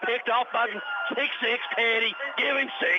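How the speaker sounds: surging play-by-play shout; excited call. Real, not acted. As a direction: yell the pick six like the crowd just rose.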